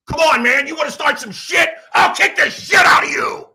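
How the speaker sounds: imitating a hothead, high shouted threat; angry yelling. Friend jumping out of car to fight, angry yelling. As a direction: explode into a hammed-up fight challenge.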